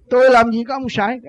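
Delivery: high, loud indignant retort; retold angry denial. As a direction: mimic a hot-tempered man denying it. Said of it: "Ông đó sân lắm".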